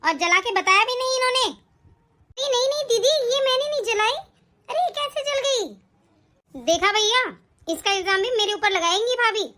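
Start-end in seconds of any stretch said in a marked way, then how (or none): 1.5-2.5 drawn-out soft gasp of disbelief; a stunned breathy no; shocked gasp. Acted. Wife hearing the burnt-shirt accusation, shocked gasp. 2.5-5.7 higher, hurried pleading denial; anxious denial. Acted. Wife to sister-in-law denying she burned the shirt, anxious.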